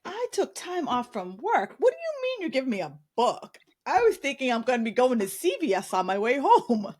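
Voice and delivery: high-pitched, incredulous mock protest; indignant retort. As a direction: act out being affronted by useless advice. Retells indignant reaction to doctor's advice.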